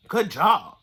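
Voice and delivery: loud, pointed "good job"; bitter "good job". Real, not acted. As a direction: spit out the missing praise. Mocking lack of praise.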